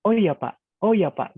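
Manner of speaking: sudden startled reply to the boss. Shown as a demonstration. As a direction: sound suddenly surprised, twice over. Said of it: "kalau terstruktur gini".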